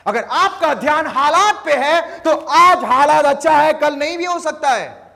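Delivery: high, loud pulpit call; full-force sermon voice; fiery preaching. Acted. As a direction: drive the point home to the crowd.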